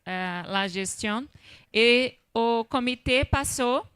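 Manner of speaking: Spanish laced with Portuguese words; portuñol. Real, not acted. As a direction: speak Spanish with Portuguese slipping in. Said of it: "portuñol es portugués con español".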